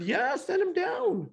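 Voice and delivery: high, drawn-out answering call; calling back. Retold or imitated. Studio crew answering the producer upstairs, acted as a high, stretched call.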